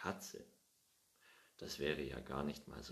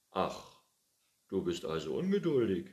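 put-on louder, quick teasing old man; amused old man; 4 semitones higher, 10 dB louder than usual. Old Pettersson teasing Findus, louder and quick. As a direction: tease the cat about being impatient. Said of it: "sagte der Alte".

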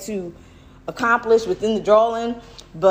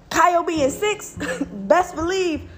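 chuckling through it, pitched up; laughing; 7 semitones higher than usual. Real, not acted. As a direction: laugh it out while insisting. Laughing while stressing son's age.

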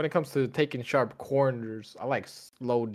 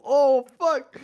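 high loud panicked yell; panicked shout; 12 semitones higher, 9 dB louder than usual. Retold or imitated. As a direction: shout in sudden fright. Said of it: "and we go".

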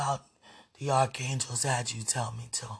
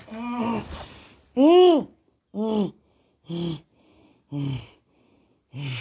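longing moans; 8 semitones higher, 8 dB louder, slower than usual. Real, not acted. Moaning while speaking to her beloved and God. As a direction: let the hums rise and fall with feeling.

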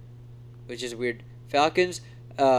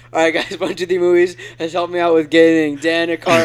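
hint of a vlogger's sponsor plug, louder; David Dobrik; 3 semitones higher, 7 dB louder than usual. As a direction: lightly imitate an upbeat YouTuber thanking a sponsor. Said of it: "That's exactly how he says it".

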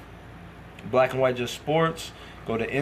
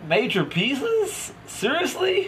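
incredulous; 12 semitones higher, much slower than usual. Real, not acted. Scoffing at the other's claim.

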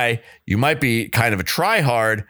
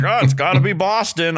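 mock eager sports fan; 10 semitones higher than usual. Mock eager sports small talk.

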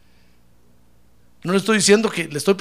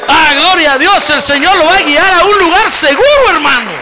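full-force high preaching shout; shouted praise; 7 semitones higher, 13 dB louder than usual. Real, not acted. Preacher promising the Lord will guide them, real exultant shout.

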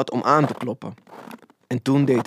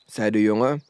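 ordinary delivery

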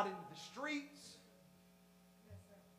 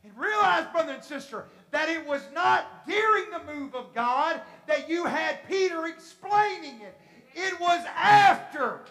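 loud, high, slow and emphatic; full-force preaching; 5 semitones higher, 8 dB louder than usual. Real, not acted. Preacher explaining the move of God to brothers and sisters, forceful. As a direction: stretch the key words and drive them home.

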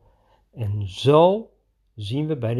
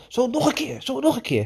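much higher, bouncy encouragement; high gleeful "once more"; playful egging on; 12 semitones higher than usual. Retold or imitated. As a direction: urge him on like a game. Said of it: "ik zei van".

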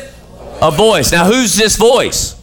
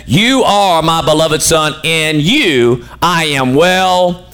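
slow solemn; 3 semitones lower, slower than usual. Acted. Preacher reading God's words in Luke.

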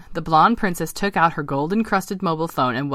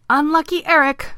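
high, louder little frog; croaking frog; 11 semitones higher, 5 dB louder than usual. Acted. Vince commiserating with his spotted friend, croaking. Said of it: "croaked Vince".

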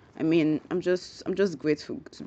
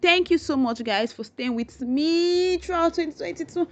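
much higher, gushing thanks; higher, eager thank-you to the listeners; excited thanks; 11 semitones higher than usual. Real, not acted. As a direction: lift the voice high with gratitude.

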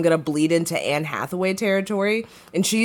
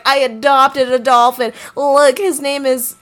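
higher, excited mock principal; imitated eager bragging; 7 semitones higher, 4 dB louder than usual. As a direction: show off a beloved dolphin, mimicking him. Voices dolphin-obsessed principal bragging.

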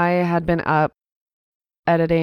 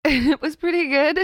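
high voice breaking into laughter; real helpless laughing, pitched high; 11 semitones higher than usual. Real, not acted. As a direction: try to answer through giggles. Answers while breaking into laughter.

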